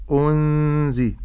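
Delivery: أنزي slow, nun hidden with nasal ghunna; correct tajweed. Shown as a demonstration. Teacher showing the correct ikhfa in أنزي. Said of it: "لكن أقول".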